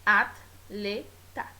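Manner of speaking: "atleta" in three slow beats, at, le, ta; "atleta" split with t closing the first beat; separated as at-le-ta. Shown as a demonstration. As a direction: pause after each syllable, at-le-ta for "atleta". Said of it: "vai ser separada como".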